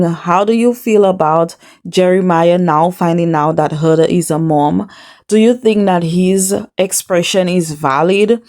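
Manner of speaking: normal talking voice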